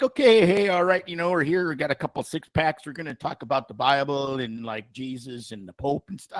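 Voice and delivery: imitated Alberta good old boy; Alberta accent. Comedian's Pope Leo as an Albertan, casual Alberta accent. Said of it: "had an Alberta accent".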